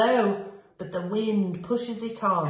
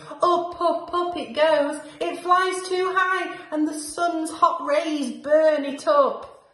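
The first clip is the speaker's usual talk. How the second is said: higher and louder, caught up in the climb; excited rising; 6 semitones higher, 5 dB louder than usual. A narrator's reading. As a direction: read it like the seed is soaring. Seed flies up into sun.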